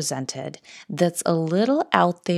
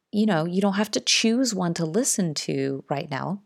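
normal talking voice

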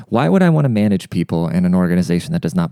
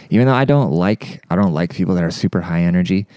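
normal talking voice

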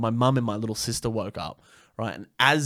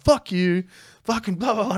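high-pitched retold drunk defiance; blustering mock comeback; belligerent mock yell; 10 semitones higher, 4 dB louder than usual. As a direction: imitate a drunk squaring up, pitched up. Acts drunken self pushing brother.

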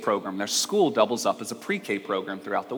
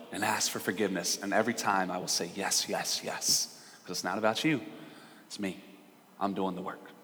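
soft, lower, gentle reassurance; tender reassurance; 5 semitones lower, 11 dB quieter than usual. Acted. God reassures Israel it's not about them, softly tender.

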